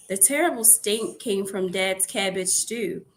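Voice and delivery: usual speaking voice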